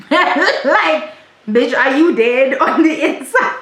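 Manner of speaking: laughing incredulous outburst, high and loud; can barely get it out for laughing. Real, not acted. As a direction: crack up while asking in disbelief. Cracking up at friends who never cry.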